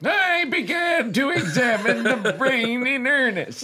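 hammed-up narration, pitched high; committed narrator voice. Reader resuming the novel after doubting the voice, hammed-up narration. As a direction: declaim it in the put-on reading voice, near laughter. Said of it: "Why did I commit to this voice?".

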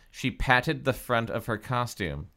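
ordinary delivery, nothing marked

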